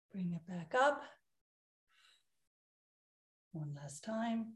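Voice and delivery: soft, calm breathing guidance; guided breathing. Acted.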